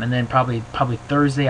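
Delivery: normal talking voice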